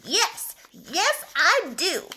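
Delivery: high, loud, excited dog voice; eager dog puppet. Acted. Spot the dog answering the teacher, eager and loud. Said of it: "This is my friend Spot".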